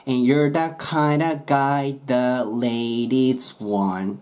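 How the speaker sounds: slow, with "kind of" run together as kinda; slow kinda demo. Shown as a demonstration. Teacher slowly modelling the lyric with kinda. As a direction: drag it out, "kind of" fused. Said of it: "kindaの音になる".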